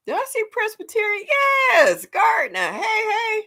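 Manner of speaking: high, loud joyful hello; thrilled shout of welcome; excited greeting. Real, not acted. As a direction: call out happily to a friend.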